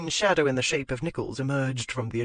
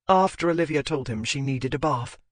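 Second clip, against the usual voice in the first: ordinary delivery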